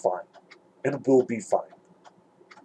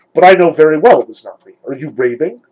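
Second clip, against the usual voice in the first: crooked grin; 2 semitones higher, 9 dB louder than usual. A narrator's reading. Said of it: "with a pale and crooked grin".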